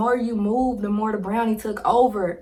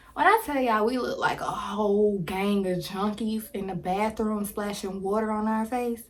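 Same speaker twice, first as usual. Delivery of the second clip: ordinary talk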